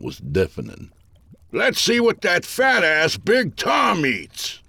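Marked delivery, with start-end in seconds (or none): 1.5-4.6 put-on high, drawn-out old-timer's holler; loud, high-pitched heckling old worker; old-timer crying out. A narrator's reading. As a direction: call it out high and slow, an indignant old-timer. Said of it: "an old-timer cried".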